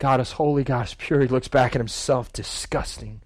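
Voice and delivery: soft, low, repetitive muttering; hushed self-disgust. Acted. Isaiah looking back at himself, muttering softly in shame. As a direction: murmur it in dawning shame.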